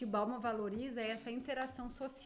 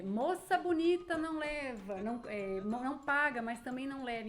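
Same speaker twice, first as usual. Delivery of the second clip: vendor banter; 3 semitones higher, 5 dB louder than usual. Shown as a demonstration. Teacher demos a vendor bargaining with a girl. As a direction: tease the customer with the rhyme. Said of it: "eu tô fazendo uma negociação".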